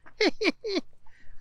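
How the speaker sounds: high-pitched howl of laughter. Acted. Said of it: "howling with laughter".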